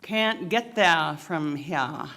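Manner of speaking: put-on slow, drawn-out Down East Maine accent; grizzled Maine fisherman's Down East accent, dropped r's; best shot at a Down East accent, unhurried. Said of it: "said in a down east accent".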